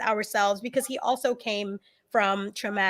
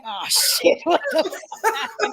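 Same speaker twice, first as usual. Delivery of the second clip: laughing mock panic; 5 semitones higher, slower than usual. Husband's startled reply, laughing.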